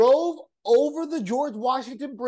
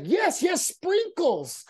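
high-pitched mock-eager confirmation; high imitation of someone finally understood; imitating eager agreement; 10 semitones higher, much slower than usual. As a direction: play an eager server, voice jumping high. Acting out delighted lady's reply.

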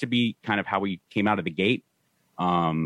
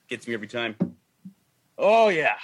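ordinary talk, nothing marked

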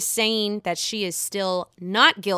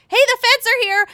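mock alarm call; 13 semitones higher, 7 dB louder than usual. Mock announcing the feds arriving.

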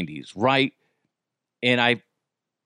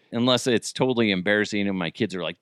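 ordinary talk, nothing marked

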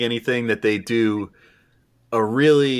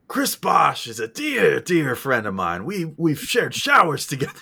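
SNL skit character; 6 semitones higher than usual. Acted. Quoting an SNL sports skit, hammed-up character voice. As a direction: stretch the words out, pitched up and theatrical. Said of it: "I would want the old S N L skit".

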